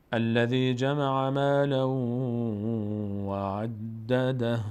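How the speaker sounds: slow, chanted Quran verse held on one pitch, عدده ending "dah"; stopping recitation. Shown as a demonstration. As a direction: chant it long and stop on the h of عدده. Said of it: "اما بهترینه که بیستیم، بگیم". (تلاوت کشیده با وقف بر «عدده»)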